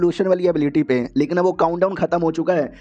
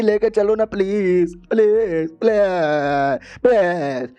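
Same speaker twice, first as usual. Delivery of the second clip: drawn-out pleading; 3 semitones higher, much slower than usual. Retold or imitated. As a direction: beg like a pestering child, dragging the words. Girl begging to be taken along.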